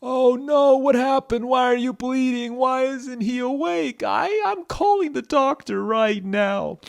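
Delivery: loud, high, drawn-out alarm; shouted, frantic old grandfather; panicked Grandpa. Acted. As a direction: cry out in a big panicked character voice. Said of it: "Grandpa was like".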